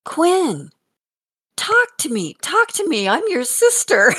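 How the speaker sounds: put-on high, pleading child's voice; little boy pleading. Grandmother imitating grandson begging his sibling, high pleading child voice. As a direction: beg like a small boy who feels ignored.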